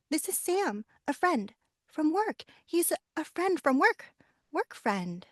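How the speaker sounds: high, hurried-sounding correction of Mom; acted anxious, high-pitched explaining; flustered insistence. Flustered daughter corrects mother's assumption.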